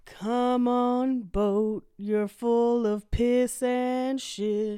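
slow, drawn-out stage voice; mock musical character. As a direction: ham it up like a showtune. Impersonating Chicago character.

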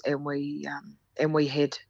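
halting, then dropping to a near-whisper on the last word; coy hesitation. Real, not acted. Storyteller embarrassed to admit what they did, coy and hesitant. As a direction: stall awkwardly, then barely voice the word. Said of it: "Look at me, even saying it like that".

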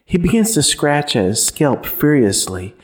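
usual speaking voice

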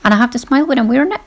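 "it" rising at the end, fast; quick line ending with "it" rising like a question. Shown as a demonstration. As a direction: lift the pitch up on "it". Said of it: "Going up at the end of my sentence".

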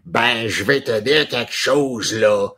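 broad Québécois French, punched out in mockery; exaggerated Québécois French accent; mimicked Québécois accent. Shown as a demonstration. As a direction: make fun of a Quebecer's way of speaking. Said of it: "that's how the Quebecer speaks".

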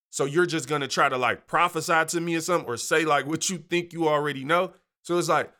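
ordinary talk

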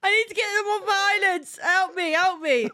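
distressed pleading. Real, not acted. Pleading for help, eyes burning.

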